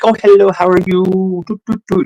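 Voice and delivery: high, sweet imitation of a cute girl; very sweet, charming. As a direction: chirp it like a charming young cashier. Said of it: "You know, very sweet".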